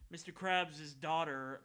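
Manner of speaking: usual speaking voice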